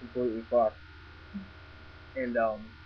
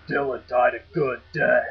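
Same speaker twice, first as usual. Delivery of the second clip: low, slow demonic voice, a bit louder; deep demonic voice; 6 dB louder, slower than usual. Retold or imitated. Retelling the voice heard in the dark, deep and demonic. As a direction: intone it like a demon. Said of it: "deep demonic tone".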